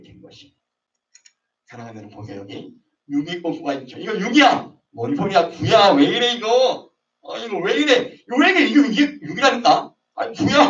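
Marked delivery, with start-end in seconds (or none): 4.0-10.7 high, heated bickering; mock argument. Two people quarreling over six or nine, high heated bickering. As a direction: argue it's six, getting more worked up.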